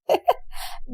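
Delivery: breathy mocking laugh; mocking giggle. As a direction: giggle at the new girl. Girls mock Hasnaa.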